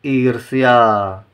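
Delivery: ईर्ष्या read slowly with r before the half ष; slow model word. Shown as a demonstration. Teacher shows where the r of ईर्ष्या is spoken, slowly. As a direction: say ईर्ष्या slowly, r sound first. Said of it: "इस आधे अक्षर से पहले करेंगे".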